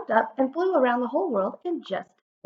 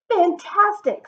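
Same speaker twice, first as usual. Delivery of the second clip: excited praise; 11 semitones higher, 9 dB louder, slower than usual. A narrator's reading. Elizabeth praising dragon.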